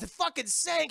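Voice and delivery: high, loud retold outrage; pissed off. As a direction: imitate her outraged disbelief. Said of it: "She's just fucking pissed".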